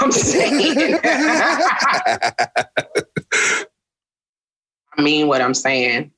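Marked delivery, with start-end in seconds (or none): 0.0-3.6 tipsy and laughing; a little tipsy, giggling. Real, not acted. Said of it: "I'm a little tipsy right now".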